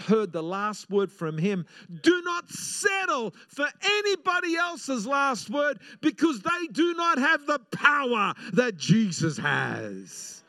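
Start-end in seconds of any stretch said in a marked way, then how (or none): 2.0-10.4 shouted high, then slow and drawn out; preacher pleading with the congregation; impassioned preaching. Acted. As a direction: command them not to settle. Preaching at full force.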